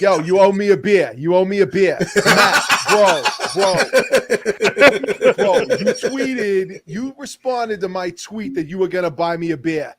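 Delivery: loud laughing Boston bro voice; Boston accent. Retold or imitated. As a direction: play a Boston guy demanding a beer. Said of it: "He's doing a Boston accent".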